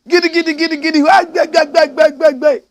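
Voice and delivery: loud, high rapid-fire nonsense syllables; mock chant. Commentator mimics the chiefs' show of cosmic power, mock chant. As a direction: rattle off a mock incantation at full voice.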